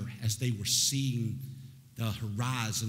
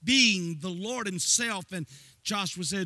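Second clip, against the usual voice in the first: much higher, louder sing-song preaching; preaching at full force; 10 semitones higher, 7 dB louder than usual. Acted. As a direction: drive it home like a revival preacher.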